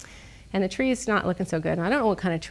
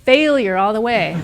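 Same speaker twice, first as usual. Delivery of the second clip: normal talking voice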